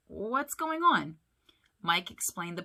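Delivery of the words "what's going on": slow, higher-pitched, mildly curious question; puzzled question. A narrator's reading. Sully noticing nobody looks festive, mildly puzzled. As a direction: ask it slowly, without alarm. Sully asks calmly, unaware of trouble.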